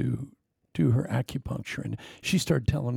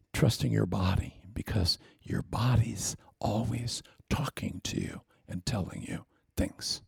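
whisper; 4 semitones lower, 6 dB quieter than usual. Real, not acted. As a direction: draw them in with a hush. Sermon lowered to whisper.

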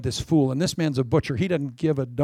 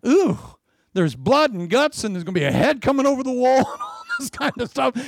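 high, excited relish of the gore; a preacher's gleeful ooh over the gore; 8 semitones higher than usual. Acted. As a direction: revel in the blood and guts like a thrilled kid. Excited gory description.

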